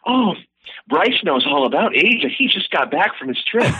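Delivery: high, fawning dinner guest; gushing tablemate. Acted. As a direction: boast about Bryce in a lifted voice. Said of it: "someone else at the table goes".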